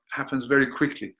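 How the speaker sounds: ordinary talk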